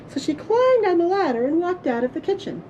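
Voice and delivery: plain reading voice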